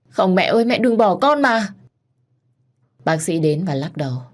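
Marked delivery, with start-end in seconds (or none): 0.1-1.7 anguished begging, slowing and rising; desperate cry. Acted. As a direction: wail out to a dying mother. Crying out as mother dies.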